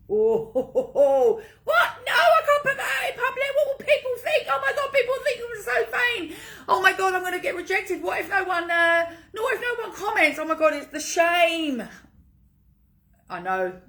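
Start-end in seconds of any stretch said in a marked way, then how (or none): 0.1-1.4 hammed-up ho ho laugh; a teasing fake chuckle; mock laugh. 1.6-11.8 panicked listener. Pretend. Voicing the listeners' panicked objections.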